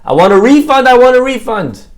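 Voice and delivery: loud, high, insistent demand; demanding refund. Acted. Husbands storm back to the doctor demanding money, loud demand. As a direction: hammer the complaint twice.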